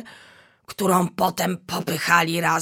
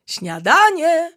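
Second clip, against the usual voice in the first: high, loud, slow call across the room; teacher elephant calling the kids to breakfast; 11 semitones higher, 8 dB louder, slower than usual. Acted. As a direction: summon the children to breakfast. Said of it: "zawołała pani słonica".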